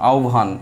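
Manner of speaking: আহ্বান with no doubled b, as "ao-bhan"; correct pronunciation. Shown as a demonstration. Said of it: "উচ্চারণটা হবে হচ্ছে এরকম".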